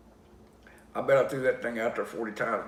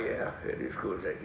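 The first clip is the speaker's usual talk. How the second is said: low, soft reply; understated agreement; 4 semitones lower, 5 dB quieter than usual. Retold or imitated. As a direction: agree quietly, pitched down. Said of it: "I'm like".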